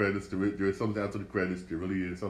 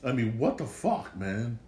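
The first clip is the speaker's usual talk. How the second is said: normal talking voice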